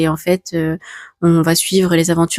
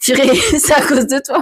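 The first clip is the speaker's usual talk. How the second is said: laughing; 7 semitones higher than usual. Real, not acted. Accuse Momo en riant.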